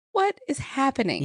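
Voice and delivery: high-pitched mock disbelief; retold bewilderment. Woman confronting Thomas about the missing girl, retold bewilderment. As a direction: imitate someone demanding an explanation.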